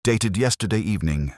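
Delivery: ordinary delivery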